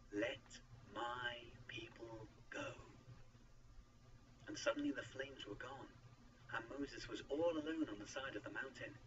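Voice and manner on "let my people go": very slow, measured pronouncement; solemn command. A narrator's reading. God gives Moses the message for Pharaoh, solemn. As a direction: draw out each word solemnly, without shouting.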